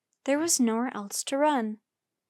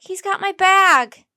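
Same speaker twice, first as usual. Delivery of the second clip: shouted cry for help, high and loud; 8 semitones higher, 14 dB louder, slower than usual. A narrator's reading. Jack yelling after the boy who stole his bag. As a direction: yell for help as the bag is snatched. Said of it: "cried Jack".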